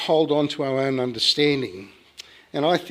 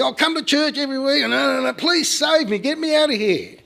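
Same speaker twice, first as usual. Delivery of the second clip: high-pitched, hurried begging; frantic bargaining; 10 semitones higher than usual. Retold or imitated. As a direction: rattle off promises, then plead high. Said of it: "did the bargaining thing".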